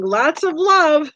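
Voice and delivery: slow, high, drawn-out lots of love. Retold or imitated. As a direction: stretch each word out sweetly. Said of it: "Rebecca's saying".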